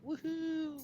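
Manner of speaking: a high, delighted cheer; excited woohoo. Real, not acted. Host cheering to open the Cup Ringside wrap-up, excited woohoo. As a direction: whoop it up for the start of the wrap-up.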